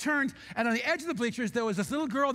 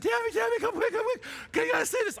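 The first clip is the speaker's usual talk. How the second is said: calling out to his wife, choked with emotion; urgent tearful call; 12 semitones higher, faster than usual. Retold or imitated. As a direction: wave her over, overcome.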